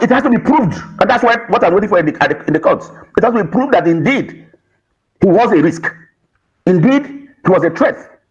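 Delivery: normal talking voice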